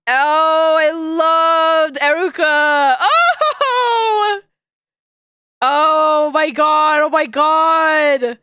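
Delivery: slow, loud delighted shout; shrieking jackpot winner. Real, not acted. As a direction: yell it like a prize win. Excited shouting at image.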